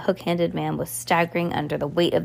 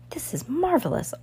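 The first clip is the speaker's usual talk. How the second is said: raspy wheezy voice; 10 semitones higher than usual. Acted. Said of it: "his voice was raspy and wheezy".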